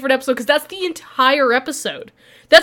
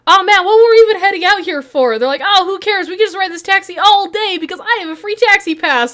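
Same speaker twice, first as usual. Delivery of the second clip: higher, breezy excitement; carefree thugs; 7 semitones higher, 3 dB louder than usual. Retold or imitated. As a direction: sound thrilled about the free ride. Thugs enjoying free taxi ride.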